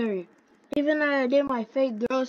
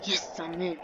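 whisper; 5 semitones lower, 6 dB quieter than usual. Real, not acted. Sneaking into a locked area.

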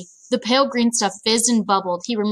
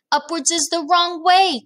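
much higher, slow storybook exclamation; indignant cry; 9 semitones higher, 3 dB louder than usual. A narrator's reading. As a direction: object in a high, drawn-out cry. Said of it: "cried the B F G".

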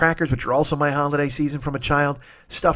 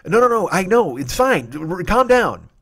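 frantic reassurance; 5 semitones higher, 4 dB louder than usual. Retold or imitated. Retelling soothing the cashier.

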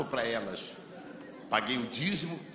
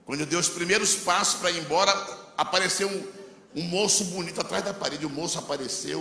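usual speaking voice

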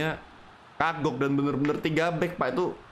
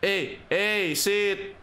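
excited yelling; 6 semitones higher than usual. Real, not acted. Yelling at teammate over a foul.

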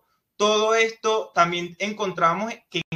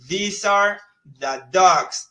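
slow model sentence; 5 dB louder, much slower than usual. Shown as a demonstration. Teacher modelling English for Spanish-speaking students, slow and careful. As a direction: spell out the English sentence slowly, word by word. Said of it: "Fíjense cómo aquí decimos".

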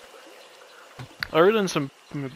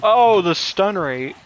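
drawn-out oh of realization; slow, surprised recall; sudden realization; 5 semitones higher, 6 dB louder, slower than usual. Real, not acted. Realizing the high stun setting.

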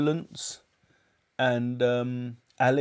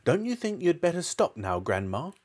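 put-on raised-pitch boy's call, falling on the name; 5 semitones higher than usual. A narrator's reading. George to Grandma on the roof, calling out. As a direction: call up to someone, mildly urgent. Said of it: "George called out".

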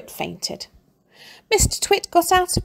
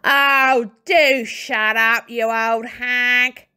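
grumpy Mr Twit; 4 semitones higher, 5 dB louder, much slower than usual. A narrator's reading. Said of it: "Mister Twit said".

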